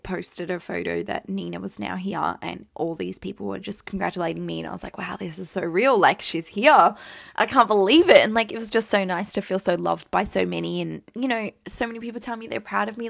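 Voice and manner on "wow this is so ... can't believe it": excited disbelief. Retold or imitated. Amazed at the newborn.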